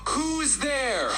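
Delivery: high, drawn-out call into the dark; stretched, high-pitched horror-story call; hesitant call. Acted. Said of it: "You hesitantly approach and ask".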